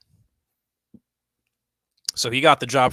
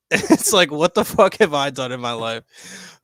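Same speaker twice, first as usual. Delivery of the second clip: laughing; 3 semitones higher, 5 dB louder, slower than usual. Real, not acted. Laughing at own failure.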